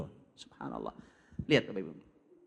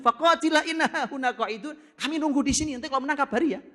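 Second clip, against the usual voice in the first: mocking sneer; 10 semitones higher than usual. Mocking Bani Israel reply.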